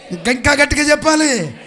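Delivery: loud, high rallying shout. Real, not acted. Urging congregation to speak even louder.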